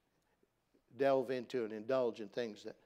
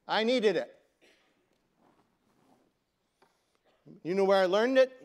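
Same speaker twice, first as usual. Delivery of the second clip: loud; 6 semitones higher, 10 dB louder than usual. Real, not acted. Preacher's loud admission.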